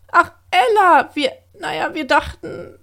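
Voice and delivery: embarrassed bird stammering; raven voice. A narrator's reading.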